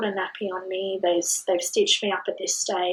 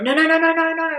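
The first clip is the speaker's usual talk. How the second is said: loud, higher protest; mother protesting as the cord is cut, loud and high; retold protest; 9 semitones higher, 7 dB louder than usual. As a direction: shout the nos to stop them.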